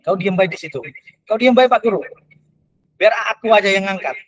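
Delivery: a villager insisting the teacher stay put, rising urgency; helpful villager. Retold or imitated. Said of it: "bahasanya begitu".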